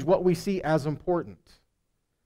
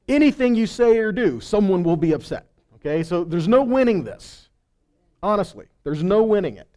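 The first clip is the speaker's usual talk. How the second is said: emphatic preaching; 3 semitones higher, 7 dB louder than usual. Real, not acted. Preacher presses the point.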